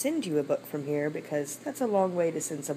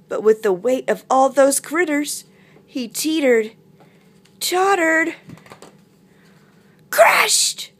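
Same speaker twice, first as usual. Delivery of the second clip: suspenseful narration; 9 semitones higher, 9 dB louder than usual. Acted. Narrator telling of bear's fall, rising suspense. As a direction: climb higher word by word. Story narration building to crash.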